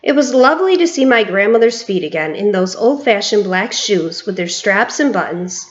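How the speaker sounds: usual speaking voice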